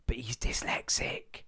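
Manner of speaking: whispered aside. Acted. Confiding aside to audience.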